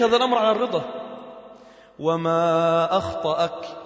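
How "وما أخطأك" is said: slow, drawn-out intoned quotation; hadith recitation. Acted. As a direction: stretch the words out as sacred text. (تلاوة الحديث بتمهّل ومدّ)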